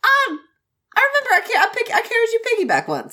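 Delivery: high, fast flustered stammer; mock nervous Wei Wuxian. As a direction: babble it high and quick.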